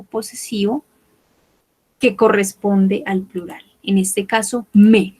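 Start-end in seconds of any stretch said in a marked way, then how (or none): 4.7-5.2 "me" said as plain me, no S sound; final S silent. Shown as a demonstration. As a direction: pronuncia "me" sin la S final. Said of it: "No pronunciamos la S". ("mes" dicho como "me", sin S)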